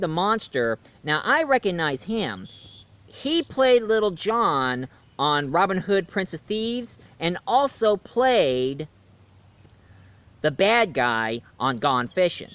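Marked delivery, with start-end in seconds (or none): none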